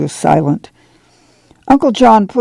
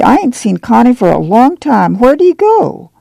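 higher, complaining whine; irritable; 6 semitones higher than usual. A narrator's reading. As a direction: fret it out, rising to a high petulant question. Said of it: "she asked irritably".

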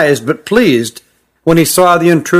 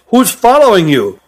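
higher-pitched, mildly raised question; loud demand; 5 semitones higher than usual. A narrator's reading. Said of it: "said Hut loudly".

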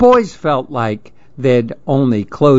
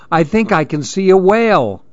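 put-on higher-pitched, mildly excited boy; excited exclaiming; 6 semitones higher than usual. A narrator's reading. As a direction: share an exciting sighting. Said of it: "Andy exclaimed".

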